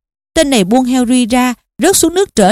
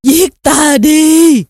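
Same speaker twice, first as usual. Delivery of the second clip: very slow, drawn-out higher cry; agonized scream; 4 semitones higher, 3 dB louder, much slower than usual. A narrator's reading. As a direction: scream it in agony, stretching each word. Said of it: "cụ thét lên". (thét lên đau đớn)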